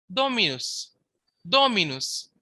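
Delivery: "Dominus" said DOH-mi-nus, falling after DO; stress on first syllable. Shown as a demonstration. Teacher giving a Latin example, "Dominus" stressed on DO. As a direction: hit the "Do" of "Dominus". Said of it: "Therefore, the accent is on do".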